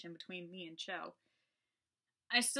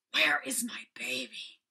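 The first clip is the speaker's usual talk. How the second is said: slow, hissing ghost whisper right into the ear; raspy hiss; 5 semitones higher, 7 dB louder, slower than usual. Acted. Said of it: "spoke in a raspy hiss".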